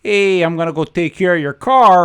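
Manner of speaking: put-on loud South Side Chicago accent. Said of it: "South Sider, it's like".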